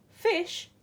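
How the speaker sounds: high, loud scornful outburst; grumbled. A narrator's reading. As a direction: spit the word out in disgust. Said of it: "the Lobster grumbled".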